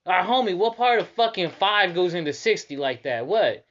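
loud, high indignant rant; mock furious complaint; outraged disbelief. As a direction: protest the price, incredulous. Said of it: "I'm like".

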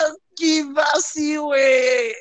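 drawn-out, high cheer; mock excited cheer. Retelling fans' reaction to the reveal, exaggerated drawn-out cheer. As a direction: stretch it out high, imitating relieved fans.

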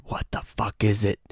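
retold half-whispered question; hushed. Asking friend about the thing beside him, hushed. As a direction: ask it under your breath, softly.

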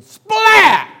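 loud high burst; a tomato smacking the boy; splat. Retold or imitated. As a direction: explode on the word splat. Tomato hits him.

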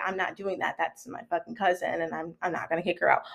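low, hushed cousin's voice; quiet refusal. Retold or imitated. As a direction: imitate a reluctant refusal under the breath. Cousin finally refusing, voiced by teller.

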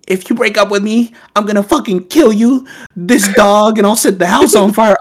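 put-on high, loud threatening girlfriend voice; crazy girlfriend. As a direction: shriek the threat like an unhinged girlfriend. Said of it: "And then she's like".

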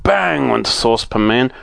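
plain reading voice

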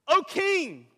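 loud salute. Acted. Daniel hails the king.

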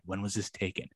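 soft, low aside; hushed retold reaction. Cop reacting to an emailed picture, hushed. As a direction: drop the voice right down for the question.

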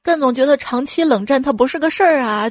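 ordinary talk, nothing marked

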